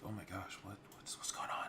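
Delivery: hushed, breathy tavern gossip; crowd whispering. Acted. Tavern patrons whispering as the armored guards enter. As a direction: whisper it as if the knight might hear. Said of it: "Whisper, whisper".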